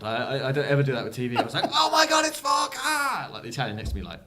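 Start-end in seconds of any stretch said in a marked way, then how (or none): 1.7-3.3 high, loud shout of recognition; excited yell. Retold or imitated. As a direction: yell it at the TV in shock. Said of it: "I, like, yelled out loud".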